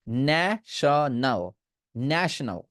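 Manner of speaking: ন্যাশনাল drawn out slowly with a short nash sound; slow careful national. Shown as a demonstration. Teacher drilling ন্যাশনাল slowly for class, short a. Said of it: "তখন বলবেন ন্যাশনাল".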